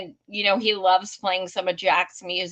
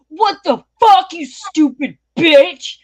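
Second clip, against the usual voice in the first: put-on loud, furious stranger yelling at a clumsy girl; angry yell; 7 semitones higher, 9 dB louder than usual. Angry dude at the concert yelling at her. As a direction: bark it out, outraged.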